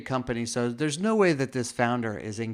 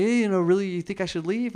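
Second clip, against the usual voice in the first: mock surprised; 7 semitones higher than usual. Asking coworkers if he should leave, high mock surprise. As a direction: play up the surprise at being told to go home.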